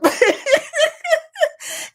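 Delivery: high, bright burst of laughter. Real, not acted. Laughing at the winner.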